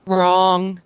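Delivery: loud, drawn-out "wrong"; emphatic correction. Real, not acted. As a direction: cut in loudly to correct them. Cuts in to correct a speaker.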